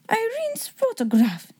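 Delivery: put-on astonished high German-accented cry; slow, amazed exclamation in a German accent. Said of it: "I hope you liked my German accent".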